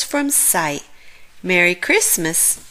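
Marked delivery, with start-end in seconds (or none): none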